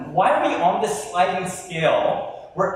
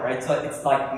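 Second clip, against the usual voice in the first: ordinary talk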